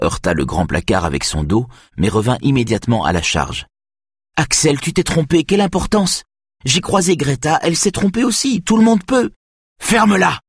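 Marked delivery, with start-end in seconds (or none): 4.4-9.3 put-on very high, pleading child's voice; high-pitched, frantic reassurance; frantic little brother. A narrator's reading. Little brother pleading during the fight. 9.8-10.4 high, furious-sounding yell; sharp narrated outburst; howled order. A narrator's reading. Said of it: "hurla Axel".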